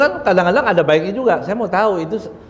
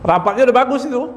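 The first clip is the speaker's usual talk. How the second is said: excited; 9 semitones higher, faster than usual. Real, not acted. Animated emphasis in sermon.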